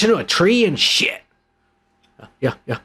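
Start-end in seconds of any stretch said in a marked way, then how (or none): none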